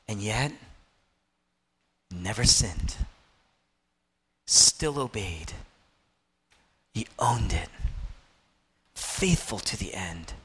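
low, soft reverent phrases; quiet pulpit awe; hushed solemnity. Real, not acted. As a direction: speak gravely, leaving long pauses between phrases. Solemn close after the climax.

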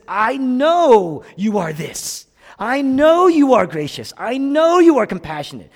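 angry, high shout with a long-held KNOW; Jonah. Retold or imitated. Pastor voicing Jonah railing at God, angry shout. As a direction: hurl it upward loudly and slowly, as an accusation. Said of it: "Jonah's angry".

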